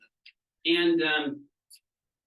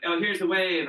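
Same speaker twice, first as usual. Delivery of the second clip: eager; 6 semitones higher, 4 dB louder than usual. Retold or imitated. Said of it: "It's like".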